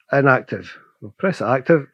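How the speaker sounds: normal talking voice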